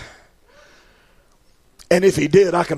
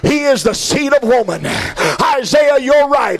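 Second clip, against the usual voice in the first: full-force preaching; 8 semitones higher, slower than usual. Acted. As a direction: preach it up to a rousing climax. Preacher builds to climax.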